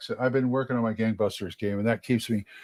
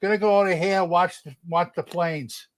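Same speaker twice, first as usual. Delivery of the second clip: higher-pitched Chicago accent, vowels stretched out; New York with a Midwest twang; 6 semitones higher, 3 dB louder than usual. Shown as a demonstration. Showing a friend how Chicagoans talk, stretched-out Chicago accent. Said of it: "take the New York accent and stretch it out".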